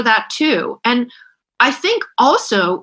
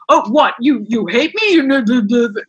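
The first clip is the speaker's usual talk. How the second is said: a hint of a dim-witted husband, light and mock. Wife mocking how husbands are imitated, mild husband voice. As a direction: lightly mimic a bewildered husband. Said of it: "they all use the husband voice".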